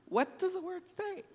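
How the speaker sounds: exasperated quote. Retold or imitated. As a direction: toss off the repeated question, voice jumping up in exasperation. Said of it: "hearing from my friends about".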